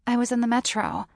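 ordinary delivery

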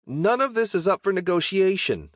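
high-pitched, only moderately loud shout; exasperated shout. A narrator's reading. Knight refuses the goblin's demands, shouting high and exasperated. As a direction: shut down the haggling, pitch jumping way up. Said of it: "I shouted".

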